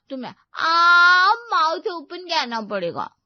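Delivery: high, loud stretched "aah"; playful demanding girlfriend. Acted. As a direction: draw out the condition teasingly. Girlfriend playfully sets a condition.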